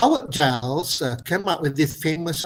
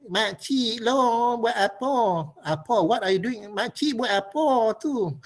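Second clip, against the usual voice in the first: put-on Perlis village accent; 7 semitones higher than usual. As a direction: coax the old aunty in broad Perlis dialect. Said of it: "if I am a politician in Perlis".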